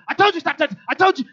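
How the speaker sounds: high, loud mock yell at a child; mock screaming parent. Pastor mimics a parent yelling at a kid, loud and high. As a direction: play the parent who lost it. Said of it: "you're screaming at 'em".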